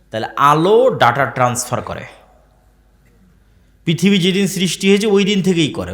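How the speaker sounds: emphatic Bengali sermon voice; sermon preaching. Acted. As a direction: preach it to the gathering.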